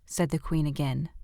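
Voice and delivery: ordinary delivery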